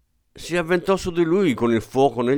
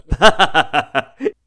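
loud burst of hearty laughter; booming laugh; 6 semitones higher, 4 dB louder than usual. A narrator's reading. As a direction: roar with laughter at the little general. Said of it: "scoppiò in una fragorosa risata". (risata fragorosa)

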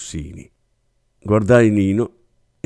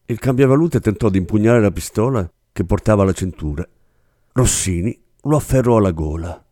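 ordinary delivery, nothing marked

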